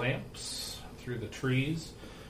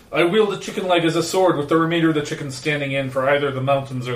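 booming arrogant alien overlord; 4 semitones higher, 9 dB louder, faster than usual. Acted. Drunk elf recounting battle.

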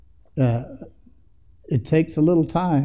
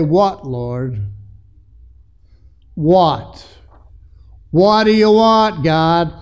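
earnest plea; 2 semitones higher, 4 dB louder, much slower than usual. Acted. Teaches how to answer God.

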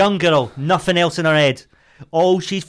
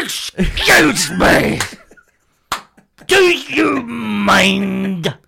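slow, much higher, loud shouting; furious yell; 4 semitones higher, 4 dB louder, much slower than usual. Shown as a demonstration. She tops her friend's angry "excuse me" with slow screamed abuse. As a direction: scream the insult out, drawn-out. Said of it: "They have".

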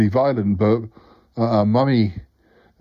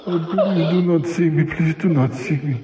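higher, pleading and scared as it comes close; frightened pleading; 5 semitones higher than usual. Acted. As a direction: beg the beast to pass you by. Pleading with approaching monster.

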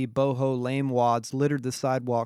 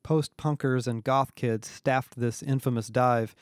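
plain reading voice

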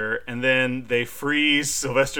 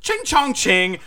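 much higher, mocking sing-song taunt; imitating the taunt; 10 semitones higher, 4 dB louder, slower than usual. Host imitating Wesley Snipes's improvised line, high and mocking. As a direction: quote the actor's taunt in a put-on voice. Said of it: "turns back around and goes".

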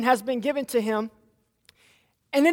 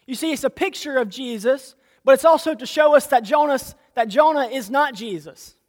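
usual speaking voice

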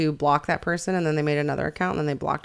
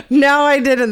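real burst of laughter, higher and louder; laughing; 6 semitones higher, 7 dB louder, slower than usual. Real, not acted. Cohost laughing at a toupee smuggling story. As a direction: laugh out loud, then squeeze out "No, I didn't".

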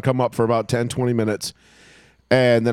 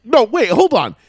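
high, slowing stunned double take; stunned disbelief; 10 semitones higher, 4 dB louder, slower than usual. Retold or imitated. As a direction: sound stunned, much higher. Realizing the comeback.

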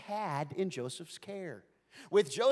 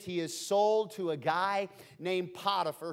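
usual speaking voice

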